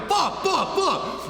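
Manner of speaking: high yelled curses, one after another; panicked shout. Retold or imitated. Realizing his girl is getting madder, high panicked shouting. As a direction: yell it like it all just fell apart. Retelling her getting angrier.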